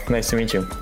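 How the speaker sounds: ordinary talk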